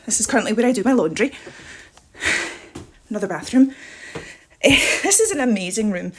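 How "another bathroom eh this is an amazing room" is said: normal talking voice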